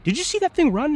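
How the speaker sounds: high, loud, urgent question; alarmed question. Retold or imitated. To the guy beside him, a high loud alarmed question. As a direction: press the guy to back you up. Asking coworker, excited alarm.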